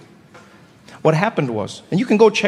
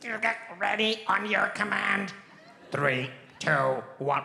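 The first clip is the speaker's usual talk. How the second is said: lower, slow, robotic alien pilot countdown; 4 semitones lower, 11 dB quieter, slower than usual. Acted. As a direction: play a stiff alien pilot launching lasers. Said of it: "two aliens in the cockpit".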